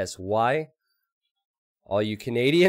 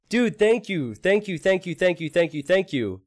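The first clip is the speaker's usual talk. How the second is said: high, gushing thank yous; overwhelmed with gratitude; excited thanks; 8 semitones higher, 3 dB louder than usual. Real, not acted.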